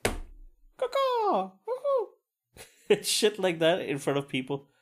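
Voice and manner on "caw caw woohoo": very high squawk, then a whoop; Kramer caw-caw and whoop. Retold or imitated. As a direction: crow call into a high-pitched woohoo. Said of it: "Kramer does this thing".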